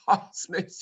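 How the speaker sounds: normal talking voice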